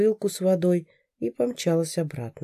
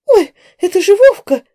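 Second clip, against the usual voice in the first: much higher, louder squeal; shrieking; 12 dB louder than usual. A narrator's reading. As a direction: shriek in shock. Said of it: "взвизгнула за спиной Раиса".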